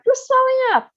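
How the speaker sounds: high loud scream. A narrator's reading. Mother screams at swelling daughter, high and loud. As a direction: scream in horror. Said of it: "screamed Miss Beauregard again".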